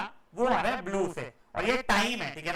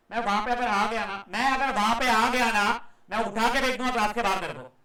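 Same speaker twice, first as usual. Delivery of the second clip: raised, forceful teacher's threat; angry scolding; 7 dB louder than usual. Real, not acted. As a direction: order the student out loudly. Teacher threatens to throw student out. (गुस्से में ज़ोरदार डाँट)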